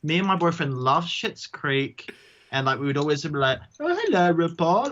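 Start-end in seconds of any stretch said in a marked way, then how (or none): 3.8-4.9 high, loud silly put-on voice, way up; Catherine O'Hara impression. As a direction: camp it up as Catherine O'Hara greeting RuPaul. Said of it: "we'd do this stupid imitation voice".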